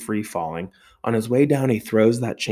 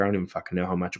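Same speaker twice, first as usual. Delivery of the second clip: usual speaking voice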